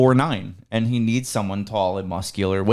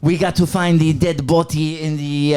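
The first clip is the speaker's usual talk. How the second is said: put-on Haitian Creole accent; 4 semitones higher, slower than usual. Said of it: "give me some of that Creole".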